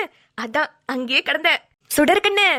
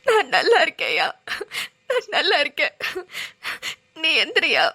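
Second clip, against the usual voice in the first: tearful, voice breaking with happy sobs; crying with joy. Acted. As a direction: weep through the words, relieved and overjoyed. Tearful reunion with old lover. (அழுகையுடன் மகிழ்ச்சி)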